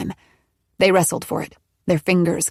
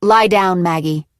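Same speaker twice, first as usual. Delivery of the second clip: ordering; 5 dB louder than usual. A narrator's reading. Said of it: "Nola ordered".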